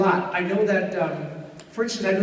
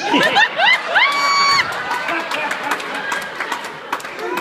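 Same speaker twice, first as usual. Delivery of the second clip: high wheezy cackle; 5 dB louder than usual. Retold or imitated. Buttram laugh.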